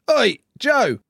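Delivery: loud, high imitated heckle; mock shout to get his attention; calling out. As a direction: yell it like a fan hailing a player. Imitates fan calling to him.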